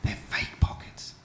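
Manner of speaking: hushed whisper in church. Retold or imitated. Wife whispering to him in church, hushed. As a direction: breathe the words with no voice. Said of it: "she leans over and goes".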